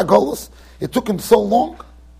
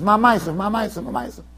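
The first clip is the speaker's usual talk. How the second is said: each repeat softer until nearly gone; fading repetition; 3 semitones lower, 7 dB quieter than usual. Shown as a demonstration. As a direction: let it trail off into silence.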